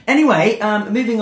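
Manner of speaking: cheery loud transition; loud upbeat anyway. Real, not acted. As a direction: snap back to energy. Loud bright topic change.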